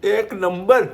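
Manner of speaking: loud, high, delighted verdict; delighted exclaim. Real, not acted. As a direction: burst out in delight at the taste. Tasting own cooked bhurji. (खुश होऊन मोठ्याने)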